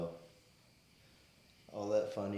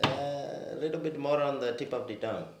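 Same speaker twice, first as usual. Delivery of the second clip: high, loud Indian accent; exaggerated Indian accent with tongue-tip consonants; 7 semitones higher, 7 dB louder than usual. Shown as a demonstration. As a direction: coach someone in an Indian accent. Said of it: "It was an Indian accent".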